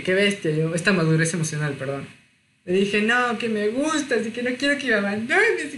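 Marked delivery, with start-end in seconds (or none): 3.0-5.7 higher, imitating a tearful plea; mock-begging as if about to cry; a touch of retold crying. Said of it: "y que me puse a llorar".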